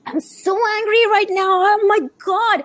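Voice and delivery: high-pitched exasperated venting; acted anger. Coach modelling a parent feeling the emotion, high exasperated anger. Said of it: "When you feel your emotion".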